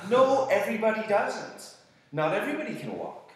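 loud, high-pitched protest; emphatic retort. Retold or imitated. Speaker correcting an ungrateful friend, emphatic protest. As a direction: push back hard, voice jumping up. Retorting to Adel's objection, acted.

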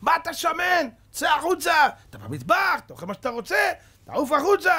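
high, furious officer's yelling; angry shouting. Retold or imitated. Officer throwing the prisoner out of the kitchen, angry shouting. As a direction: shout accusations and orders in a high voice.

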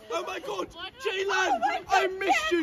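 high, gushing mock drunk; put-on high-pitched drunken affection; Summer drunk. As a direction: squeal it like a clingy drunk reunion. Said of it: "I'm Summer".

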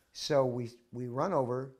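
ordinary talk, nothing marked

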